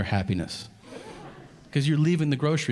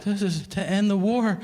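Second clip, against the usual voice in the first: mock petitioner voice; 9 semitones higher than usual. Petitioner pitching a cause.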